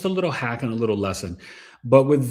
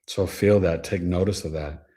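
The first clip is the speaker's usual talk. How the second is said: ordinary talk, nothing marked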